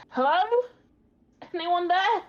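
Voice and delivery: high, loud searching call; calling out shout. Acted. Lost character shouting to see if someone will answer, calling out. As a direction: shout out for anyone who might answer. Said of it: "going to start to shout".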